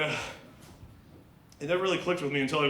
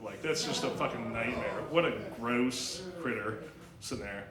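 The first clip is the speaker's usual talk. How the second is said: ordinary talk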